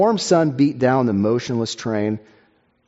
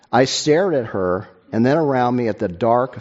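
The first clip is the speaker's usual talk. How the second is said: ordinary delivery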